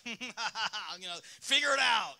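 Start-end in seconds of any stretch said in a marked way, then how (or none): 0.2-1.0 mock villain cackle; Riddler. God as a riddling villain, mock cackle. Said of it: "God is like the Riddler from Batman". 1.4-2.1 loud, high villain taunt; Riddler. Acted. God posing riddles like a villain, taunting shout. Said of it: "God's like".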